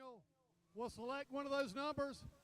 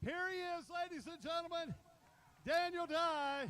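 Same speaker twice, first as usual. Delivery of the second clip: announcer introduction; 3 semitones higher, 3 dB louder than usual. Real, not acted. Track announcer presents race winner to crowd, big announcer introduction. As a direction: build to the winner's name, drawing it out loudly.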